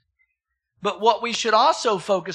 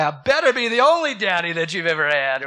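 loud mock-stern dad; loud fatherly mock threat; mock warning; 6 dB louder than usual. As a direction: playfully warn her, louder. Dad's mock warning to daughter.

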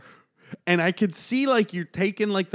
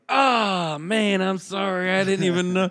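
mock apology; 4 semitones higher, slower than usual. Imitating apologetic comics.

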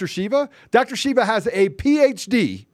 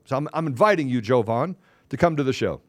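ordinary delivery

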